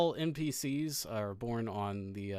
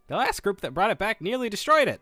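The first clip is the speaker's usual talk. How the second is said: high cheery voice; 8 semitones higher, 5 dB louder than usual. Acted. NPC Fumbler.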